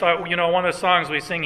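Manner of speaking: ordinary talk, nothing marked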